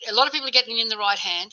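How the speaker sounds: normal talking voice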